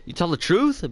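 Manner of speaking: rising, surprised disbelief; surprised question. A narrator's reading. Julio reacting to Ben's confession, surprised. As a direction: ask it with mild astonishment. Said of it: "Ben responded with the same amount of surprise".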